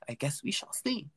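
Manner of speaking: playful airy half-whisper; airy teasing repeat. Pretend. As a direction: repeat it in a high, hushed voice.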